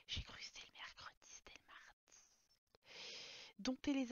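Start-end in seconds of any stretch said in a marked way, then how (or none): 0.1-2.2 soft unvoiced confession; whisper. Player voicing her Sim's guilty homework slip, whispered.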